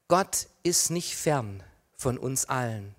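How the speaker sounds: usual speaking voice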